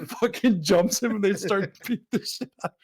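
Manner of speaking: ordinary talk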